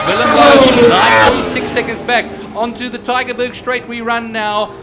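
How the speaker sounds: loud, energetic race call; motorsport commentator. Real, not acted. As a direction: call the lap over the crowd noise.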